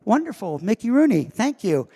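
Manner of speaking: mock gushing parents thanking their son; delighted parents. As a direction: gush like proud parents. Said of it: "and they said".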